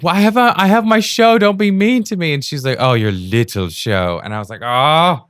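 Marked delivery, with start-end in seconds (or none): 0.0-2.3 high, loud mock pleading; retold whiny protest. Acting own protest in quarrel. 2.8-4.2 slow, loud belittling taunt; imitating a mocking partner. Partner's taunt in retold quarrel. 4.6-5.2 high, loud wounded exclamation; mock hurt cry. Hurt reaction in retold quarrel.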